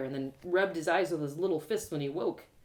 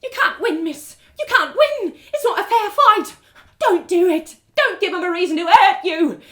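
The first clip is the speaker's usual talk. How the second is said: put-on much higher, louder frantic old beggar; frantic pleading; 11 semitones higher, 13 dB louder than usual. Beggar woman drags narrator back, frantic. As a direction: beg her to stop, panicked.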